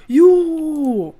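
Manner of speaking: loud, high outburst at the animal; sudden frustrated yell; exasperated shout. Real, not acted. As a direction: yell it at the stubborn sheep.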